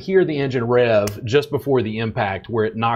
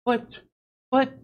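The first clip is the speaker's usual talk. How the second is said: high stammering protest, much higher; mock sputtering; 11 semitones higher, 4 dB quieter than usual. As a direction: sputter like a flustered student. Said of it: "they're like".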